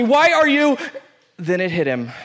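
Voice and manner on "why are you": higher, louder alarmed yell; yelled back. Retold or imitated. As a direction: shout the question back in alarm. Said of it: "Rob yelled back to me".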